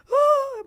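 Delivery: sharp, high, loud gasp of fear; a first-time mom's startled gasp; frightened gasp. Retold or imitated. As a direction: gasp as if your child just tripped. Mother as her toddler falls.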